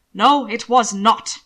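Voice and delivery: "No, it was not" slow and loud; emphatic denial. A narrator's reading. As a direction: weigh each word of "No, it was not" heavily. Emphatic answer on a disputed decision.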